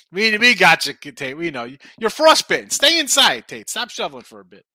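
loud teasing. Real, not acted. Teasing a chat viewer.

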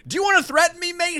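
very high, heated yell; acted angry scream. A narrator's reading. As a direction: scream it as a challenge. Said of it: "he screams".

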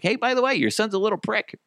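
very high, chirpy imagined quote; mock-friendly heads-up. Dad imagines telling a mom about her son, high mock-friendly. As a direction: deliver the insult in a high, casual sing-out.

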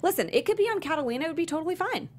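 high and quick, selling the idea; breezy high reassurance. Real, not acted. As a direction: pitch it up high like it's no big deal. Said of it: "reassuring friends about options".

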